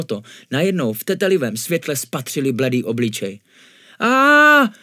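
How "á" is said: loud, high-pitched startled cry; frightened scream. A narrator's reading. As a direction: let out a long scream of fright. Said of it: "vykřikl Trdlo". (vyděšený výkřik)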